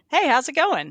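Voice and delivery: hammed-up breezy greeting; chirpy greeting. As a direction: bounce in like an oblivious coworker. Passerby greeting a busy estimator.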